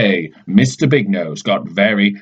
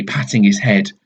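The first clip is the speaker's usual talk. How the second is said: plain reading voice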